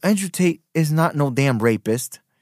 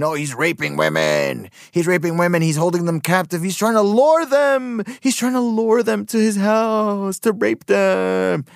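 rising, higher mock-outraged voice; mocking accusers; 7 semitones higher than usual. Vlogger parrots the accusers to mock them, hammed-up outrage. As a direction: ridicule the accusers' hysteria.

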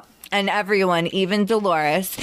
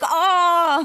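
shocked gasp; 10 semitones higher than usual. Retold or imitated. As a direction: react like the room just froze. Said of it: "all the girls are like".

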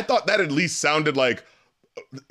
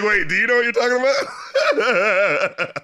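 laughing; 4 semitones higher, slower than usual. Retold or imitated. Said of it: "it's like".

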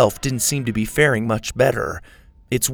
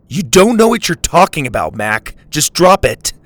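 louder, snapping back; angry; 5 semitones higher, 8 dB louder than usual. A narrator's reading. Dylan tells Mac to drop it, loud and angry. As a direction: shut him down hard.

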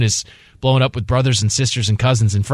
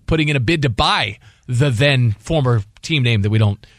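usual speaking voice

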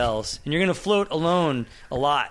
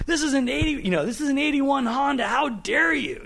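high-pitched yelling impression, a bit louder; retold yell; 13 semitones higher, 3 dB louder than usual. Man yelling a movie line, high retold yell. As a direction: act out his indignant yell, pitched way up. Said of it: "And he yells that".

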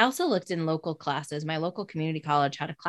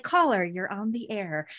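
radio host voice; 3 semitones higher than usual. Retold or imitated. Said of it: "I'd make up another voice".

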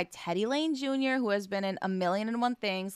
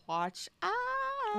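high squeaky warning; 14 semitones higher, 5 dB quieter than usual. Acted. Threat to Obama, high squeal.

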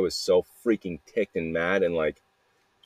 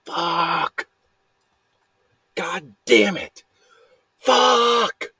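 high, drawn-out yell of frustration; reenacted screaming; 11 semitones higher, much slower than usual. Showing how they were cursing out in the junkyard, imitated screaming. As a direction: act out screaming curses, each one stretched out. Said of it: "screaming and just yelling".

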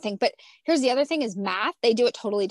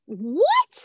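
higher, louder, incredulous; baffled what; 7 semitones higher, 6 dB louder than usual. Retold or imitated. Mom staring at daughter's math homework, incredulous yelp. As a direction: throw it out like a startled yelp.

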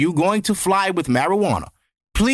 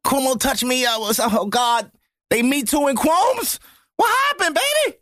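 mock accuser voice; 7 semitones higher than usual. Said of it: "Now it's people saying".

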